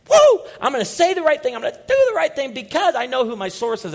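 very loud, high, rallying cry; exuberant whoop. Real, not acted. Preacher rallying the congregation with a loud, high whoop. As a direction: fire up the room.

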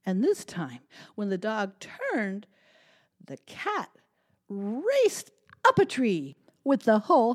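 put-on lively, animated read-aloud for kids; storytime narration. A narrator's reading. Librarian reading a story to children, animated. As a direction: tell it big and bright for little listeners.

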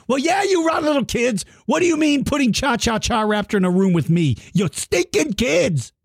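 hammed-up angry creature shout; El Stinko screaming. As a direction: bellow at those rotten kids like a cranky monster. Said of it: "and the El Stinko screaming".

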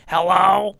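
put-on high, loud nasal-free older New York woman hello; Joan's voice. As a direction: answer the phone like a brassy older lady. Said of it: "this woman sounded like this".

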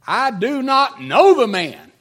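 very loud, high, vehement denial; cursing denial. Acted. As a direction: shout the denial with full force. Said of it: "he began to invoke a curse on himself".

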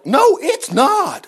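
high, drawn-out objection; emphatic rebuttal. Real, not acted. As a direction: stretch out an indignant high denial. Rebuts a saying he just quoted.

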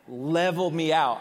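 loud, punchy burst; emphatic shout. Real, not acted. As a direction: boom it out to the room. Preacher recalling being confronted, booming.

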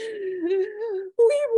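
sharp high gasp on the edge of tears; emotional gasp. Retold or imitated. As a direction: catch your breath as the tears come. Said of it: "And I was like".

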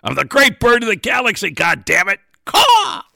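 high, loud squawky bird-god boast; hammed-up bird deity crowing; great bird voice. Bird god proclaiming himself to the crew, high and loud.

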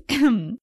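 a pointed, fake throat clear; theatrical ahem; mock throat clear. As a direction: clear the throat to flag the euphemism. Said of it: "cough, cough".

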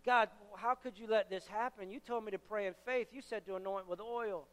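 soft, subdued complaint in prayer; upset with God. Retold or imitated. Young believer to God after failed healing, quietly upset. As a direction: question God quietly, voice dropping away. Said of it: "and I'm upset with God".

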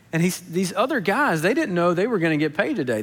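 usual speaking voice

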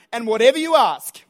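loud, phrase by phrase, pauses between; emphatic preacher's call-and-response lead; leading a congregational repeat. Real, not acted. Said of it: "Say this after me".

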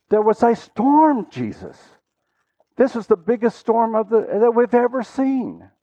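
high, incredulous retort, words stretched; indignant disciples' protest. Acted. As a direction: protest in disbelief as a scared disciple would. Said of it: "They might have been thinking".

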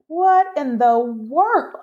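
slow, high-pitched disbelief; shocked. Retold or imitated. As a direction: re-enact being stunned, stretching every word. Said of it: "I was shocked".

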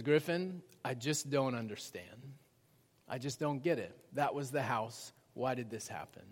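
low, hushed bewilderment; imitating tearful wife. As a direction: retell her heartbreak softly, voice dropped. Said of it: "and she's in tears".

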